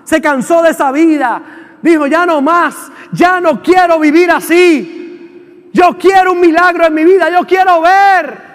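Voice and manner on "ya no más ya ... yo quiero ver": pleading shout, climbing higher toward the end; desperate shout. Acted. Blind beggar who is fed up shouts his plea, desperate. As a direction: cry out to be free of that life.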